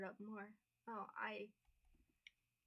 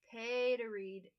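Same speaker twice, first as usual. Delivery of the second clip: slow, loud disbelief; fed-up groan at a paywall; exasperated; 7 dB louder, much slower than usual. Real, not acted. Paywall appears on article.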